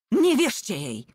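loud high shout. A narrator's reading. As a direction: shout the warning out. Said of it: "krzyknął więzień". (głośny krzyk)

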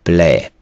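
"пле" as French plaît, t silent; French reading. Shown as a demonstration. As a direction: model "пле" ending on an open e. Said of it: "на кінці не промовляється".

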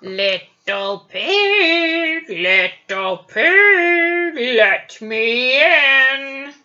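slow, drawn-out wolf call. Acted. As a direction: growl it slowly like a wolf. Wolf voice in story.

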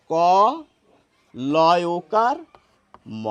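"ক লয় ওকার ম" spelled out slowly, letter by letter; "ক লয় ওকার ম" drawn out to show the hidden o sound; slow spelled-out pronunciation. Shown as a demonstration. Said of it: "এর উচ্চারণ কিন্তু". (ধীরে ধীরে ভেঙে ভেঙে বলা উচ্চারণ)

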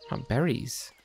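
ordinary talk, nothing marked

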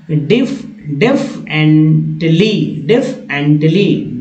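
"डेफिनेटली" slowly as def-en-tlee; slow model pronunciation. Shown as a demonstration. Teacher giving the actual pronunciation, slow model pronunciation. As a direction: draw out "डेफिनेटली" syllable by syllable. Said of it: "उच्चारण जो है इसी तरह".

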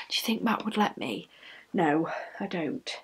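a private whispered no; whispered aside. Real, not acted. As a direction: whisper the answer like a secret. Answering own question about husband.

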